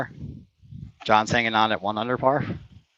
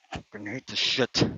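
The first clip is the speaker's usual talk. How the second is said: muttered curse; 6 dB quieter, slower than usual. Real, not acted. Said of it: "Excuse my language".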